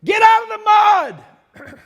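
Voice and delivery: high, loud yell across the mud; hollering. Acted. Calling to someone stuck in mud, loud high holler. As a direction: holler a warning to someone sinking. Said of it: "I would holler to them".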